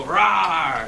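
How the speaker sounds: loud playful tiger "rawr"; big mock roar. Shown as a demonstration. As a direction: roar like a tiger to show the crowd. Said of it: "strike this pose and go".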